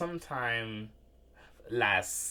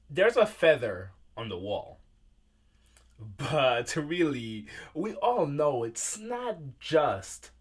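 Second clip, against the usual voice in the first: poetry reading. Acted. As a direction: recite it like a live poem, letting each phrase hang. Reads own poem aloud.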